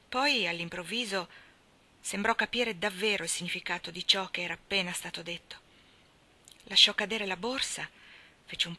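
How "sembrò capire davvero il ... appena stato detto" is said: plain reading voice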